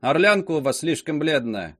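plain reading voice

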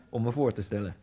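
ordinary delivery

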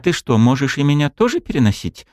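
surprised question, voice up high. A narrator's reading. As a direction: ask it in disbelief. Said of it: "удивлённо спросила она".

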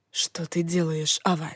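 leaning in to whisper. A narrator's reading. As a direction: lean close and whisper it. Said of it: "он наклонился ко мне и прошептал". (шёпот наклонившись)

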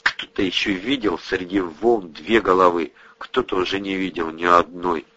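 plain reading voice

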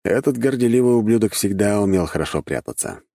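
plain reading voice

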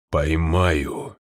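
low growling threat; menacing rumble. Acted. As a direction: growl it as a warning. Er's warning rumble.

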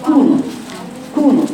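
كونوا with rounded lips on the damma; full damma. Shown as a demonstration. Teacher models كونوا. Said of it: "مثلًا".

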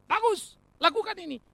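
high, loud acted order; acted voice of a master; commanding master. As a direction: give a servant a brisk command. Acting the master ordering a servant.

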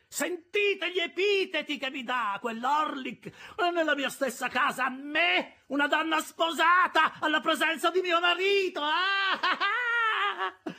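very high-pitched outraged wail; hysterical shrieking. A narrator's reading. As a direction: work up to shrieked sobs. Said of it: "dopo aver strillato e battuto le mani".

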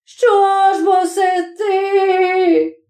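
very high, long-drawn wail; pained wail. A narrator's reading. Chub struck by the smith, pained, angry wail. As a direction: wail it out, stretched and hurt, vexed. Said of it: "заголосив Чуб таким голосом, в яким чути було і біль".

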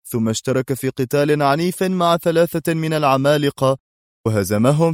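humouring, high-pitched sing-along disbelief; talking to a child. A narrator's reading. Policeman doubts the old man's tale, talking as to a child. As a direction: recap it as if indulging a small child. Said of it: "في لهجة من يحادث طفلًا".

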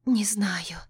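hushed, unvoiced whisper. A narrator's reading. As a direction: breathe it in a whisper. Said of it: "прошептала я".

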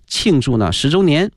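usual speaking voice

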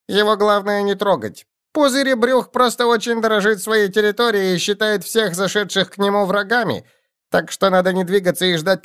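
high excited goblin chatter. A narrator's reading. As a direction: babble like an eager goblin. Goblin shaman explains.

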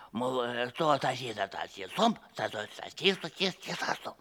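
low, halting stutter; stuttering servant. Acted. A stuttering servant struggles to say "neighbour", low and halting. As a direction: get stuck on every syllable.

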